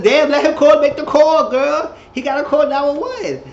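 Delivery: loud, high outburst; exasperated yelling. Retold or imitated. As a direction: yell at her to back off. Retells her exasperated shout at screen.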